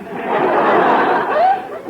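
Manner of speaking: a high, breathless giggle at her own joke; high airy giggle. Acted. Wife giggling at teasing her husband, high airy giggle.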